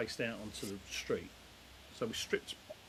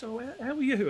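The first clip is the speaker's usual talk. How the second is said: put-on high, louder sweet old lady greeting; 14 semitones higher, 9 dB louder than usual. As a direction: chirp it like a chatty old neighbour. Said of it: "She goes".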